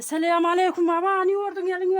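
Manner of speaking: high, loud and quick greeting. Real, not acted. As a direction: greet a hard-of-hearing father loudly. Loud greeting to old father.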